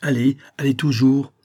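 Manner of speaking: high, loud push to keep going; urgent urging. A narrator's reading. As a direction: drive him on, no stopping. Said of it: "il disait au Watman". (pressant, plus fort et plus aigu)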